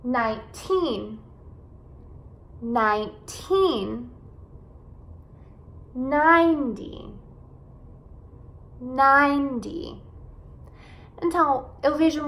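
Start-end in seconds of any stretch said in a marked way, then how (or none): 0.0-4.1 put-on NINETEEN slowly, with a long TEEN; nine-TEEN, drawn-out careful model; stress on final syllable. Shown as a demonstration. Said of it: "esses números com teen". 5.9-10.0 NINETY slowly, long strong NINE; NINE-dy, falling light ending on NINETY; stress on first syllable. Shown as a demonstration. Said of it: "enfatizar as sílabas no começo".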